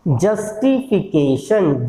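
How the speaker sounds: "जस्टिफिकेशन" slowly, every syllable clear; slow careful pronunciation. Shown as a demonstration. Teacher introducing a word to count, slow careful pronunciation. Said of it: "कितनी sound आई इसके अंदर".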